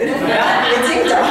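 slow, laughing through the answer. Real, not acted. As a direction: giggle it out.

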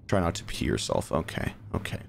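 hushed, scared self-talk; nervous whisper. Real, not acted. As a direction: whisper to calm yourself down. Nervous in horror game.

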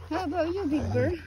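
ordinary delivery

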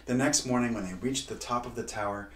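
usual speaking voice

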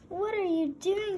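high startled shout. A narrator's reading. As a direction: yell it out in alarm. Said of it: "shouted Arnie".

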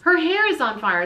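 loud, high alarm; panicked shout. A narrator's reading. A diner shouts in panic about Rita's hair. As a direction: cry out in panic.

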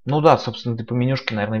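ordinary delivery

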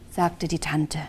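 ordinary delivery, nothing marked

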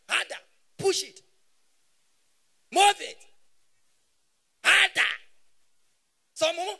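mock urging. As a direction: egg him on, hammed-up and loud. Mock bedroom urging, comic bit.

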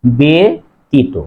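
ব্যতীত slowly as "be... tito"; slow model "be, tito" for ব্যতীত; syllable by syllable. Shown as a demonstration. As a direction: pause after the e syllable in ব্যতীত. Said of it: "উচ্চারণ হবে".

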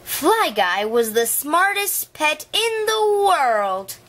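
ordinary delivery, nothing marked

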